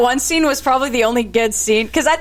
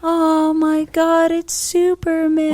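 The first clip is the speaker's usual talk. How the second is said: slow, drawn-out awe, higher voice; mock realisation; 4 semitones higher, much slower than usual. Podcaster imitating Lois as the light goes off, mock realisation. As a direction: let it dawn very slowly, hammed up.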